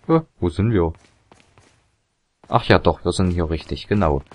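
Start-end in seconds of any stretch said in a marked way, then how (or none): none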